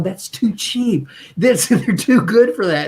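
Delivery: high, laughing disbelief; retold amazement. Telling Gary the speakers are too cheap, laughing in high disbelief. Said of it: "And I'm going".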